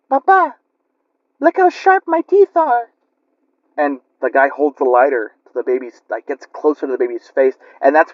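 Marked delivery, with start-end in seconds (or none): 0.1-2.9 put-on much higher, eerie little child voice; sweet-sounding monster baby, high and sinister; creepy baby voice. The demon baby shows the uncle its sharp teeth, high eerie voice. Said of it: "And he's like".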